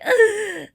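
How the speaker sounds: a loud, high groan of disgust; disgusted ugh. Retold or imitated. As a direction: let out a big fed-up ugh. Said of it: "I'm like".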